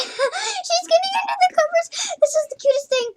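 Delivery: excited squeal. Real, not acted. Gamer gushing at her cat under the covers, high excited squeal.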